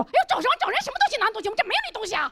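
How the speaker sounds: high-pitched, fussing shopkeeper; scolding boss's wife. Retold or imitated. Boss's wife rushing over to deny it, scolding. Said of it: "老板娘就飞过来跟我说".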